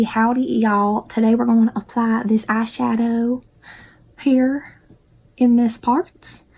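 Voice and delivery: hint of a country bumpkin West Virginia accent, slow drawled greeting; lightly put-on country West Virginia accent. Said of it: "talk like this".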